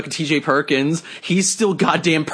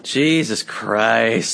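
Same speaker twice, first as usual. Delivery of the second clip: exasperated groan; 5 semitones lower, much slower than usual. Real, not acted. Exasperated again.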